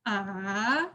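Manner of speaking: AH dipping low, then swinging up; long "ah" that dips and rises; swinging third tone. Shown as a demonstration. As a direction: swing "ah" down and back up. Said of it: "you can do a swing".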